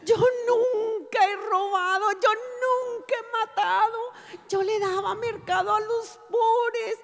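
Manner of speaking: high, wailing protest of innocence; crying out, pleading. Retold or imitated. Woman pleading her innocence from the pit, wailing. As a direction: plead tearfully in a high, stretched voice. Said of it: "Empecé a gritar".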